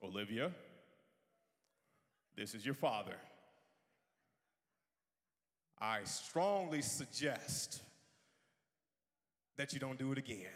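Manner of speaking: low, measured, mock-stern dad; fatherly voice. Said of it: "in my fatherly voice".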